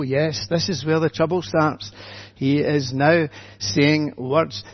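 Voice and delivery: ordinary talk, nothing marked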